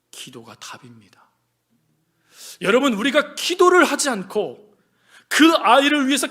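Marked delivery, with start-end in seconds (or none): 0.1-1.3 very soft, low, slow statement; quiet, solemn conviction; hushed testimony. Acted. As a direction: let it out slowly, almost whispered. Elder's testimony quoted, hushed.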